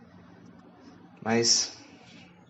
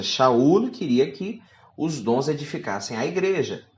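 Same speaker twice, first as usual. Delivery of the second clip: normal talking voice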